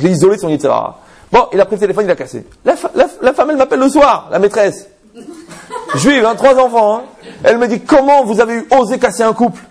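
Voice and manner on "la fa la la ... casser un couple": higher, laughing through the story; laughing retelling. Real, not acted. Rabbi laughing as he retells the mistress's outraged call. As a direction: tell it while laughing at the absurdity.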